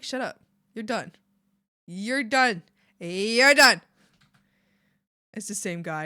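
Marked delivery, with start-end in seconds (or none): 0.0-3.8 calm to loud, getting louder each time; the dog's verdict, growing into a firm shout; voicing the dog, building. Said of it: "he's just like".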